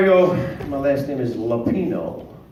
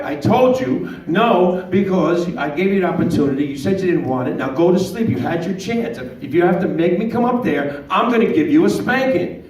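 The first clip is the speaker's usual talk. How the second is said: stern dad; 6 semitones higher, 4 dB louder than usual. Retold or imitated. Dad scolding child.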